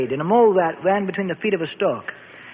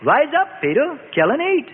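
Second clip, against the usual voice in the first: commanding voice from heaven, more insistent; insistent heavenly voice; 7 semitones higher than usual. A narrator's reading. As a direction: press the order on Peter again. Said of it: "the voice out of heaven said the third time".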